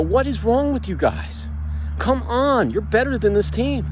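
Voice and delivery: high-pitched, slow, exasperated pep talk in a timeout; rally the team like a fed-up coach; frustrated coach. Retold or imitated. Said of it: "take a timeout and go".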